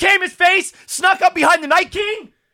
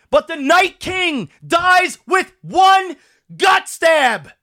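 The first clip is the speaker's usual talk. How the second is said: usual speaking voice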